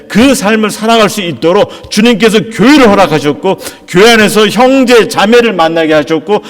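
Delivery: pressing the point home from the pulpit; impassioned preaching. Real, not acted. Preaching builds to forceful climax.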